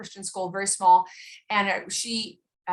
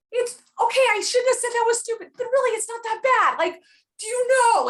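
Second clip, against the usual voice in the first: high, loud, fast hammed-up reassurance; mock soothing mother; 5 dB louder than usual. Mother calming daughter crying over "stupid," hammed-up high reassurance. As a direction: coax the sobbing kid, much higher.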